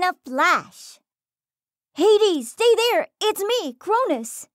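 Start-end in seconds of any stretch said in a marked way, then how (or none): 1.9-4.5 high, loud call across the plain; yelling. Acted. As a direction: shout to stop her. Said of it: "she yelled".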